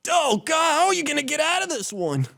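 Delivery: anime hero. Acted.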